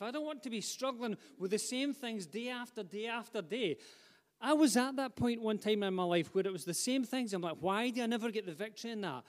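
impassioned sermon to the congregation; fervent preaching. Acted. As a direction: preach it with rising fire. Preacher building to full force.

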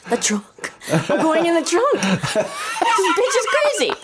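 high, laughing inner panic; laughing mock panic. Panicked thought, laughing retold.

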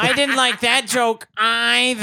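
high, whiny mock complaint; mock whiny protest. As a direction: whine it like a fussy child.

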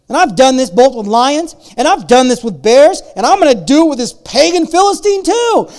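loud, high, proclaiming voice; dramatic scripture reading. Acted. Pastor reading David's reply to Saul, booming proclamation. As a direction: read it like a battle cry. Reads scripture at full force.

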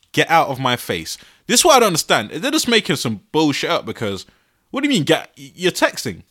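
ordinary delivery

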